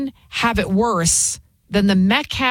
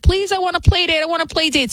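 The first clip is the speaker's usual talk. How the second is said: put-on much higher, begging little girl voice; pleading child; 9 semitones higher, faster than usual. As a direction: beg like an excited child for a play date. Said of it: "She was like".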